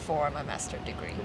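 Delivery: usual speaking voice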